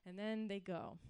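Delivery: usual speaking voice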